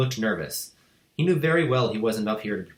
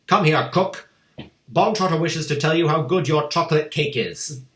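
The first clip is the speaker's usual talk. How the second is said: higher, loud summoning call; shouted; 7 semitones higher, 7 dB louder than usual. A narrator's reading. Headmistress summoning the cook to the stage, loud. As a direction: call her in triumphantly.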